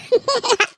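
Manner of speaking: high, sudden laugh; high burst of laughter. Real, not acted. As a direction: laugh out at your own sass. Laughs at her own joke. (mataas na biglang tawa)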